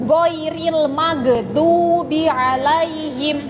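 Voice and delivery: ghairil maghdubi recited slowly, tongue held so the consonant does not bounce; held tongue recitation. Shown as a demonstration. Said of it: "Tapi ditahan lidahnya".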